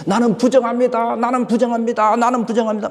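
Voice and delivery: high, loud repeated cry of warning; calling out. Retold or imitated. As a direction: shout it so the village hears. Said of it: "막 소리를 지르는 거예요". (동네 사람들에게 부정하다고 외치는 소리)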